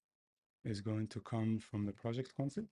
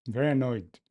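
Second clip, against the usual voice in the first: very annoyed, said much louder; 2 semitones higher, 11 dB louder than usual. Shown as a demonstration. Coder declares his annoyance at the code, loudly. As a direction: announce the annoyance out loud.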